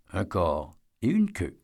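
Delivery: ordinary delivery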